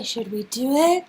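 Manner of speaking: normal talking voice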